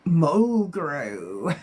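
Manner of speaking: lightly witchy, drawn-out word; a bit witchy. Shown as a demonstration. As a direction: stretch it out with a hint of witch. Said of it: "Like a bit witchy".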